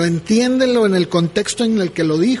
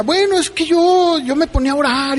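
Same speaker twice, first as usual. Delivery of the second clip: higher, quick whiny excuse; excusing son; 6 semitones higher than usual. Retold or imitated. Pastor mocking the son's excuse to the congregation, higher and quick. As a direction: play the lazy son making excuses.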